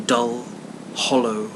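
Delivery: plain reading voice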